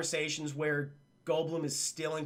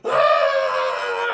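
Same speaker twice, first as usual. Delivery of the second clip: long, very loud imitated scream; agonized scream; 13 semitones lower, 11 dB louder than usual. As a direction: scream at full volume as if in horrific pain. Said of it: "he's just going".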